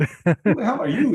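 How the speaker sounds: loud, high retort breaking into laughter; mock challenge. Stranger challenging whoever opened the door, loud mock indignation. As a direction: fire it back, half laughing.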